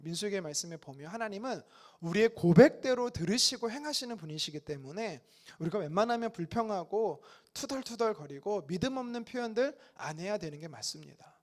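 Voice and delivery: ordinary delivery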